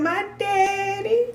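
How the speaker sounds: put-on lightly high, slow child voice; a hint of a small child; little girl voice. Niece naming who bought shoes, slow child. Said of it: "She said".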